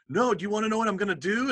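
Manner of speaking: retelling how you pushed your wife to hear the big idea; eager excitement. Retold or imitated. Retelling eager 2am announcement to wife.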